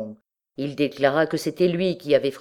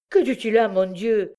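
alarmed outcry, higher pitch; raised, appalled question; crying out; 5 semitones higher than usual. A narrator's reading. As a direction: exclaim in a mother's dismay. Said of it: "s'écria Miette".

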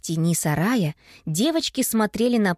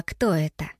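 usual speaking voice

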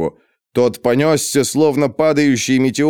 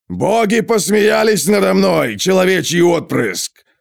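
booming thunder and wind; 7 semitones higher than usual. A narrator's reading. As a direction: roar it out like thunder. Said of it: "проревел он голосом, подобным грому".

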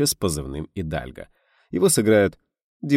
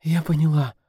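breathless babble; 9 semitones higher, 3 dB louder than usual. A narrator's reading. Actress answering the director, breathless babble. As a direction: stammer it out, short of breath. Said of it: "пролепетала актриса, задыхаясь".

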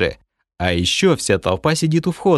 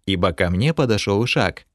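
usual speaking voice

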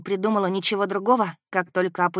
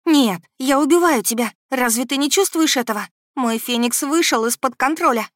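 high, loud, desperate outburst; panicked protest; 7 semitones higher, 5 dB louder than usual. A narrator's reading. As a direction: plead with him to see the danger. Panicking heroine argues with prince. (в панике, с отчаянием)

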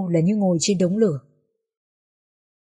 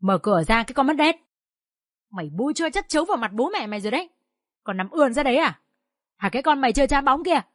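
higher, fast furious father; booming rage; 5 semitones higher than usual. Acted. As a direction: roar at the daughter behind the door. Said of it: "tiếng ông đã oang oang". (bố quát tháo giận dữ)

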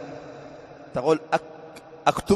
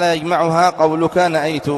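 reciting text; 5 dB louder, slower than usual. Acted. Reads the grammar text aloud.

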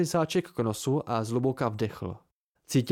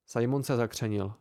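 usual speaking voice

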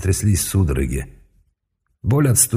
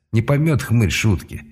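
usual speaking voice

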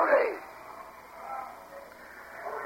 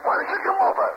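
high, heated challenge; angry dare. Real, not acted. Barman dares the caller to come over, angry. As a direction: dare him to come, furious.

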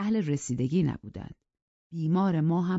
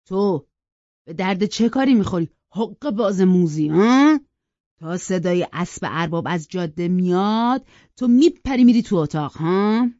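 loud, scornful accusation, pitched up on the questions; sharp-tongued; 3 semitones higher, 9 dB louder than usual. A narrator's reading. Servant turning on the housekeeper as useless, sharp-tongued. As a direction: round on her with stinging, jabbing questions. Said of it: "با زبان نیشدارش".

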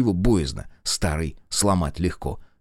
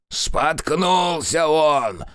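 put-on grumpy old grandfather; 6 semitones higher, much slower than usual. A narrator's reading. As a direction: bellow up the stairs at the fool grandson. Grandfather answers mockingly.

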